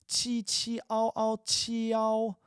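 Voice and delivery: qi, ao and qiao very slow and high; slow qiao demo. Shown as a demonstration. Teacher repeating qiao for students, very slow and high. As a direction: stretch qiao out syllable by syllable. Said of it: "You hear the I?".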